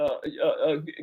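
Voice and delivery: normal talking voice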